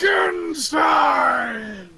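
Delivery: slow, high monster bellow; Frankenstein's monster. Acted. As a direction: stretch the name out triumphantly. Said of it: "giant behemoth of a corpse man".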